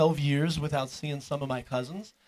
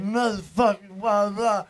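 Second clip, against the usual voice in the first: put-on drunk rambling; 2 semitones higher, 3 dB louder, slower than usual. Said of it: "He's all drunk".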